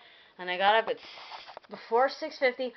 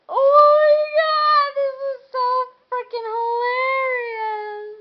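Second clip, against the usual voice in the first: very high, loud, slow squeal of delight; excited squeal; 10 dB louder, slower than usual. Retold or imitated. Vlogger reliving braiding the Sims cats, squealing. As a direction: shriek with laughing glee. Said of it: "And I was like".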